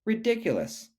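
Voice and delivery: high scoffing duck voice; lightly quacky duck scoffing at Shelley; mocking duck. A narrator's reading. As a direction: sneer it like a smug duck. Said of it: "quacked the duck".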